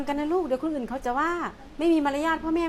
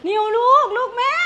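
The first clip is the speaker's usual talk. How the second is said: put-on high, louder doting cry to her child; gushing mother; 7 semitones higher, 5 dB louder than usual. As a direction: rush in gushing over the daughter. Mother rushes to spoiled daughter.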